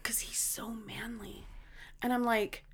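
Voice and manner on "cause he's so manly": slow, whispered admission; retold hushed worry; imitating tearful friend. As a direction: breathe it out slowly and very softly. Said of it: "he's bawling".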